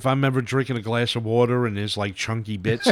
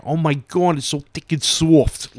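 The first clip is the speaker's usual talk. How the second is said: imitated New York gushing, higher and louder; higher, louder New York "sawft"; broad New York accent; 6 semitones higher, 4 dB louder than usual. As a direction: gush like a New York woman at a bar. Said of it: "Like, that's spelled S A W F".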